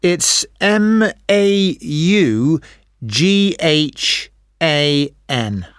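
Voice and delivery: M A U G H A N spelled slowly, each letter separate. Real, not acted. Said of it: "just a bit more complicated".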